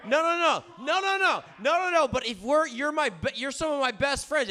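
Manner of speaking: high, loud shouting over others; forceful protest. Real, not acted. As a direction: override their objections at the top of your voice. Talking over friends' objection.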